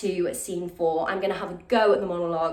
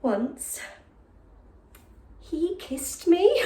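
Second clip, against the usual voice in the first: higher, impassioned Shakespearean soliloquy with long dramatic pauses; lovesick jailer's daughter; 10 semitones higher, much slower than usual. Acted. Continues the monologue.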